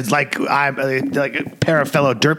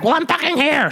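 high, exasperated retort; indignant friend; 14 semitones higher than usual. Retold or imitated. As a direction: pitch jumps way up in mock outrage. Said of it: "She's like".

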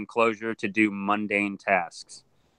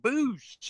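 whoosh of a croc. Retold or imitated. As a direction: make the sound of something bolting away. Croc bolting into the water.

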